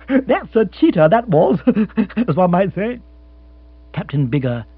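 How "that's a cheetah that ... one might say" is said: high chuckling quip; laughing at own joke. Acted. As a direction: chortle through the pun. Lord laughing at his pun.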